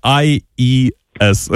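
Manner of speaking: slow spelling, letter by letter; spelled out. Shown as a demonstration. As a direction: spell Д, А, І, С one at a time, slowly. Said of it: "от пишеться". (повільно, по літерах)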